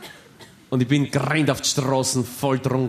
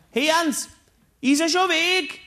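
very high, squeaky put-on voice for the groundskeeper; groundskeeper's voice; slower than usual. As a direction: pitch it way up like a falsetto caretaker. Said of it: "Und dann sagt er zu mir".

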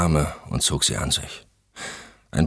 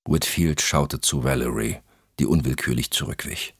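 plain reading voice

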